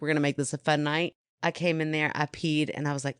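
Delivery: ordinary talk, nothing marked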